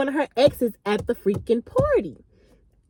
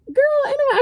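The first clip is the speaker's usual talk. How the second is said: put-on high squeal of girl ain't nobody; playful brush-off; 13 semitones higher than usual. Brushing off an upset friend, high playful squeal. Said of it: "So I'm like".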